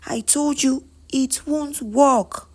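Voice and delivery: high-pitched, emphatic self-defence; insistent protest. Acted. Ebuka reminding her he wanted Lade, protesting. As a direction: argue the point insistently, pitch held up throughout. Man defends his past choice.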